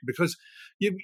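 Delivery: ordinary delivery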